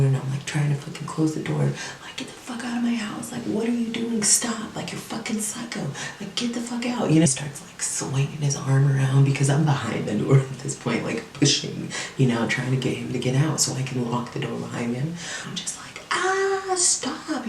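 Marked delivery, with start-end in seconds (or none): 2.2-7.1 high-pitched panicked pleading, held down in volume; strained, high mock yelling without the volume; retold panic. Re-enacting shoving him out the door. 16.1-17.5 very high mock scream at normal volume; thin, high cry of protest; retold scream. Re-enacting screaming at intruder.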